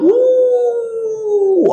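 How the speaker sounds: very high, loud mock wail; a full-throated hired-mourner howl. Shown as a demonstration. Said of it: "Tapi kalau ramai menangis".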